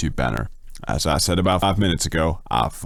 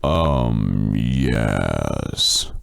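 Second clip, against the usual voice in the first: very low, slow and stretched; deep drawn-out voice; 7 semitones lower than usual. Pretend. Streamer saying what a viewer asked, deep drawn-out voice. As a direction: sink low and draw each word out. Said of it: "is what the morpher asked me to say".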